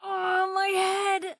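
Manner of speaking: slow, high, woozy moan; dazed groan. Acted. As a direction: drag it out like the room's spinning. Dazed after a blow.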